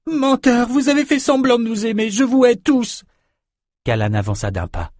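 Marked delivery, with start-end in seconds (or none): 0.1-3.0 put-on very high, raging young girl; furious outburst. A narrator's reading. Samantha accuses them all of faking love, high furious outburst. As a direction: cry out hatred in a very high voice.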